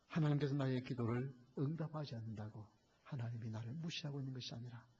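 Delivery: ordinary talk, nothing marked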